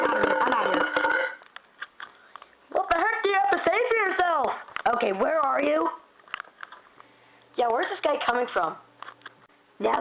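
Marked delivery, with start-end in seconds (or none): none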